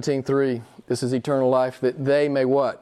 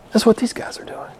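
breathy whispered aside; 7 semitones higher than usual. Shown as a demonstration. Preacher whispers the point to the congregation. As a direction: whisper it like a secret.

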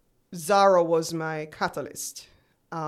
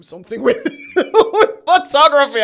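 laughing; 9 semitones higher, 14 dB louder than usual. Retold or imitated. Laughing in disbelief at manager.

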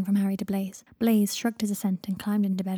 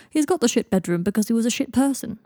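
breezily cheerful jab; mildly chirpy and blunt; 3 semitones higher, 5 dB louder than usual. A narrator's reading. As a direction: say the insult cheerfully. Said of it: "said Blaise cheerfully".

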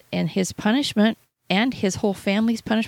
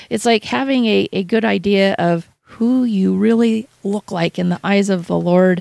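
ordinary delivery

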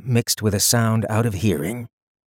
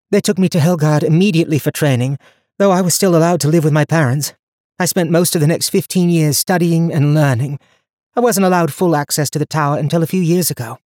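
lightly voiced young heroine, higher; young woman voice; 7 semitones higher, 4 dB louder, faster than usual. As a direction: speak her lines higher and brighter. Donia describes her training.

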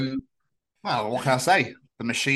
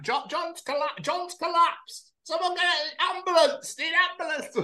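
high, slow breathless commentator call; panting commentator; slower than usual. Retold or imitated. Commentator running with the runners, out of breath. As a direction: gasp it out as if running all the way. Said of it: "By the end, be like".

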